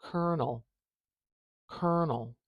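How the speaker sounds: "Colonel" said as KER-nel, carefully; model pronunciation. Shown as a demonstration. Teacher asking viewers to listen, model pronunciation. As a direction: say "colonel" like "kernel", falling on each take. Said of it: "Listen to the way I say it".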